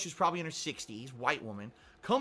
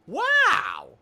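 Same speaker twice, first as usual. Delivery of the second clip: stunned wow; 12 semitones higher, 9 dB louder than usual. Retold or imitated. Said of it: "I was like".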